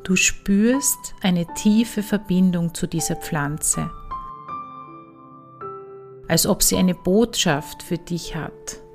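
calm, unhurried meditation voice with long pauses; guided meditation. Acted. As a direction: ease the listener into relaxation. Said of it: "Weiter geht es nun mit einer Meditation".

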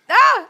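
surprised cry. Real, not acted. Wrong guess revealed in quiz.

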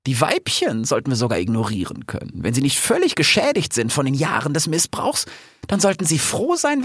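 high-voiced scheming lioness plans the attack; a cunning lioness savouring her plan, pitched high. Aisha plotting the revolt with her pack, high and scheming.

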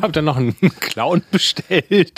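laughing while joking. Real, not acted. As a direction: giggle through the words. Joking over partner's story. (lachend erzählt)

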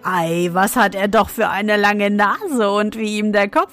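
jeering crowd calling out. Acted. Townsfolk jeer at the dwarf in the street, mocking calls. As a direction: shout the taunts at the odd little dwarf. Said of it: "Überall hörte er rufen".

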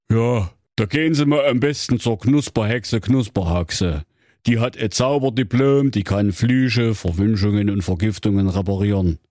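Grimm brother as a call-centre recording; a polite hotline greeting from Jakob Grimm. Acted. Grimm advises. (Jakob Grimm als Hotline-Ansage)

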